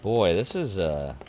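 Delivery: ordinary talk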